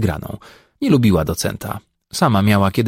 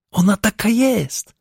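high, slow mock housewife voice; man teasingly imitating a squeaky wife; 12 semitones higher than usual. A narrator's reading. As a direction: squeak it like the play-acted wife, giggling. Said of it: "w rewanżu przejmując rolę Zaworka".

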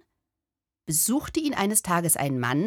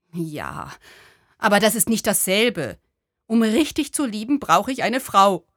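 insistent sad man; 3 semitones higher, 4 dB louder than usual. Acted. To the little Buddha, a lovesick man mildly insisting. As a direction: argue it like someone sure he needs a wife.

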